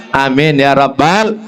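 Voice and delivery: ordinary talk, nothing marked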